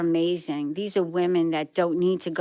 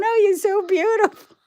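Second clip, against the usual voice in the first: put-on much higher, louder, gushing baby-talk coo; husband cooing at dog; 13 semitones higher, 5 dB louder than usual. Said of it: "when he was going".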